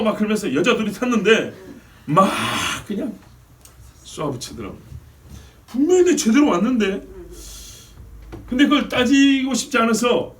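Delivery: loud, high, impassioned sermon telling; preaching at full force. Acted. Pastor tells his congregation the parking story, preaching loud.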